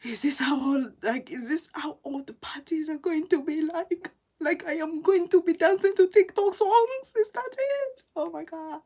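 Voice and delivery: rising, loud panicked complaint; stressed-out wail. Retold or imitated. New student phoning a friend from a dead party, panicking loudly. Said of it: "I was stressing".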